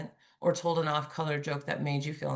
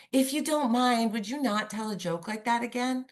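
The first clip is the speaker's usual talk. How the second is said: "If you don't mind" pitched high, "again" left lifted like a question; questioning, lifting up; 6 semitones higher than usual. Shown as a demonstration. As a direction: ask it tentatively, "mind" and "again" held up high. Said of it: "so it's more like a question".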